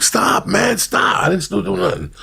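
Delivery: high pained mock yelp; a hammed-up high cry of pain; Stinky yelping. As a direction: yelp it like the dog's biting you. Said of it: "And Stinky would".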